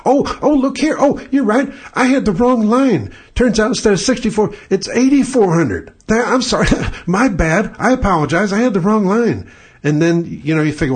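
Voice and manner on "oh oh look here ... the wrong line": high, sheepish fake apology; mock apologetic adjuster. Adjuster calls back, suddenly sheepish and apologetic. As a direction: play the caught-out claims guy, all innocent. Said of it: "And they say".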